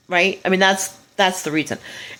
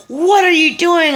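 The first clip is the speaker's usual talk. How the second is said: much higher, louder furious demand; angry father; 11 semitones higher, 5 dB louder than usual. Retold or imitated. The absent father storms in and shouts at the kids, angry. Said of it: "comes storming angrily".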